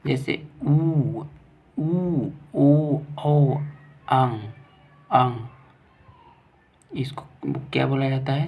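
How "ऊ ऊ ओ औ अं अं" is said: ऊ, ओ, औ sounded singly with rounded lips; rounded vowel demo. Shown as a demonstration. Teacher showing students rounded vowels, demonstrative. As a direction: draw out each vowel from ऊ to अं. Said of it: "जिस स्वर के उच्चारण में".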